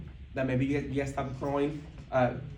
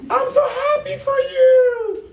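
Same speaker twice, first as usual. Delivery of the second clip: much higher, slow laughing voice of the coach; older mentor; 9 dB louder, much slower than usual. Retold or imitated. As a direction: gush like a proud mentor. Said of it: "Marlene says".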